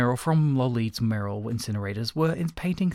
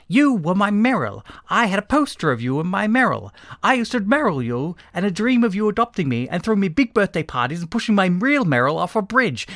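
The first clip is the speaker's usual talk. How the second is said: high, loud, wounded accusation; impassioned outburst; 8 semitones higher, 6 dB louder than usual. A narrator's reading. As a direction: pour out the grievance at full pitch. Fallen idol confronted in outburst.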